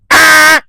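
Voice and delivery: loud buzzer-like "ah"; imitated error buzz; mock error buzz. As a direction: bark out the program's reject noise. Mocking the program's error buzz.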